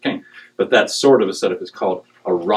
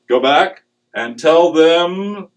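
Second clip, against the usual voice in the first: slow voice of God; 6 semitones higher, 4 dB louder, much slower than usual. Retold or imitated. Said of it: "God says".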